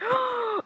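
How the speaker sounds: high shocked gasp; mock gasp. Imitating boyfriend's shocked gasp. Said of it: "He's like".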